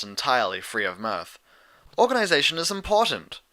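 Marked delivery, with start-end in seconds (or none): 2.0-3.4 defensive. A narrator's reading. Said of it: "defending herself".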